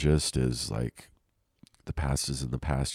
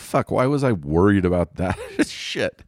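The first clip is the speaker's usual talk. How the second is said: loud, higher-pitched acted self-reproach; hammed-up exasperation at needless worry; mock relieved disbelief; 5 semitones higher, 5 dB louder than usual. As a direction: blurt it out, laughing at yourself. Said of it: "You're like".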